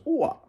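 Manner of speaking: high "Ooh-ah" falling away; moose call. Retold or imitated. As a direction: sing out "Ooh-ah" like a moose calling. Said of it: "why a moose goes".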